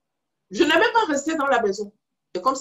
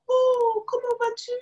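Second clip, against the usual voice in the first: put-on cooing at a baby; 11 semitones higher, slower than usual. Woman greets the baby.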